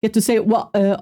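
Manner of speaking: playful British glottal stops; mock British accent. Streamer wishing to be British, mock British accent. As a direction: put on a rough British "what uh". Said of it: "I would love to be British".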